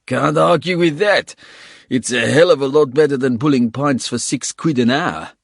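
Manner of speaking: loud, gleeful South African voice; South African accent. Acted. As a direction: gloat about the money, South African accent. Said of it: "South African accent".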